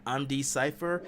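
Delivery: ordinary delivery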